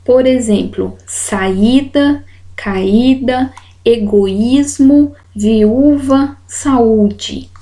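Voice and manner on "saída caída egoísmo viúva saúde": "saída, caída, egoísmo, viúva, saúde" with each í or ú held as its own stressed syllable; stressed hiatus vowel. Shown as a demonstration. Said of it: "forem tônicos e estiverem sozinhos".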